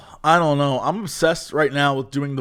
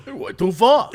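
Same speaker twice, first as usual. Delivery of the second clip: Chicago ad guy; 6 semitones higher than usual. Retold or imitated. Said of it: "I love that".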